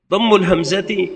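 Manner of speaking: ordinary talk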